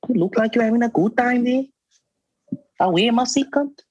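sing-song South African hype greeting; South African accent. Retold or imitated. Asked for South African accents, a high teasing South African voice. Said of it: "there's, like, a colored one".

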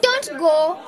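high, slow call to stop him; calling after him. Acted. To Charlie as he walks away, calling him back. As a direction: reach him before he walks off.

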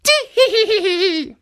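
tittering high-pitched cackle; high old woman giggle. Acted. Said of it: "could not help laughing".

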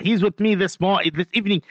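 ordinary talk, nothing marked

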